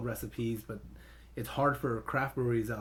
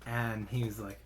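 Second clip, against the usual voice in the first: usual speaking voice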